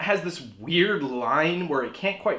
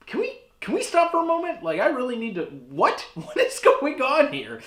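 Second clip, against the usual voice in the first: bewildered; 10 semitones higher than usual. Retold or imitated. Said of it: "nobody's like".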